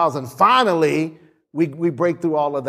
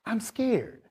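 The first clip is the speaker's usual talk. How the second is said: put-on soft scared child; 4 semitones higher, 10 dB quieter than usual. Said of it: "it's okay to be scared".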